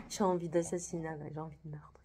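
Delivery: hushed whisper, half-joking menace; whispered threat. Retold or imitated. Telling her colleague she wants to kill him, whispered. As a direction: breathe it out like a confession of murder. Venting murderous frustration to colleague.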